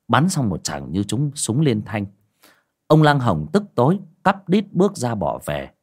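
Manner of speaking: usual speaking voice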